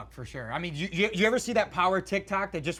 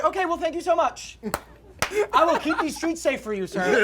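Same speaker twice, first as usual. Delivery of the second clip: overly polite; 9 semitones higher, 4 dB louder than usual. Retold or imitated. Mock polite to the cop.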